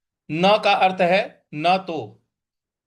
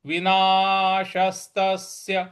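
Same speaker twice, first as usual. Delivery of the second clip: विनाशस्तस्य drawn out slowly with half स clear; slow and careful, each syllable apart; much slower than usual. Shown as a demonstration. Teacher models the half स slowly. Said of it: "इस प्रकार से बोलेंगे".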